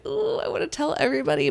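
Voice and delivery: high, fast and bubbly, bursting to share; giddy excitement. Retold or imitated. Late-night excited thought, high and fast. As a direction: gush like you can't hold the news in. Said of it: "I'm so excited that I'm just like".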